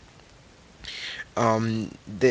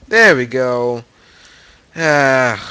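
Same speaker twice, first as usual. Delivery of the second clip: loud exclamation; 3 semitones higher, 11 dB louder, slower than usual. Real, not acted. Game loads, exclaims.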